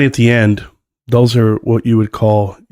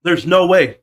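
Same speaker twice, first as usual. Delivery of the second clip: higher, disbelieving "There's no way"; incredulous; 8 semitones higher, slower than usual. Real, not acted. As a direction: say it like you can't believe it. Repeats disbelief, first take.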